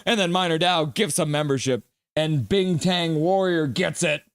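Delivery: ordinary talk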